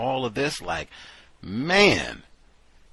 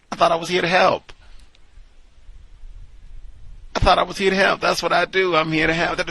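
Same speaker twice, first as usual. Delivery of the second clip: indignant instructor; 7 semitones higher, 6 dB louder, faster than usual. Retold or imitated. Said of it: "the instructor's indignant complaint".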